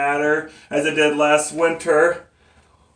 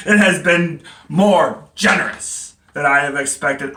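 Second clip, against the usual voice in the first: normal talking voice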